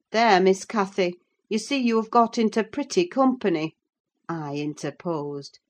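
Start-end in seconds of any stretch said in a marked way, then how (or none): 0.1-3.8 slightly raised, quick scolding; chiding housekeeper. Acted. Housekeeper interposes to her young charge, chiding. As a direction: chide the girl in a higher voice.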